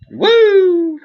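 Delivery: high shouted woo; excited whoop. Real, not acted. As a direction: let out a loud joyful whoop. Excited cheer alone.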